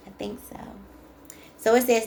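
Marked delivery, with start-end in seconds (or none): 0.1-0.6 a soft unsure whisper to herself; whispered aside. Real, not acted. Unsure reader whispering to herself about the card. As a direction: breathe it as a private aside.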